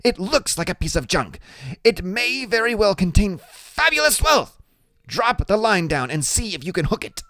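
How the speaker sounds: put-on high, brisk know-it-all boy; a small genius giving orders; brainy small boy. A narrator's reading. Boy hurrying his big friend along, bossy and high. Freak's voice in the narration.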